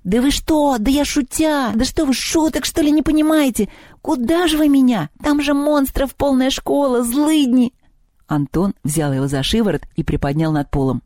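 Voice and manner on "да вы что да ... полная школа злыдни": high-pitched frantic goblin protest; squealing, indignant little house spirit; panicking house goblin. Acted. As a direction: plead in a high, alarmed goblin voice.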